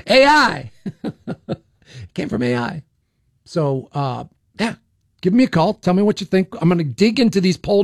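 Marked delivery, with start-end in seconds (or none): 0.1-2.8 laughing joke. Real, not acted. Radio host jokes about the accent's origin, laughing. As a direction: crack yourself up on the punchline.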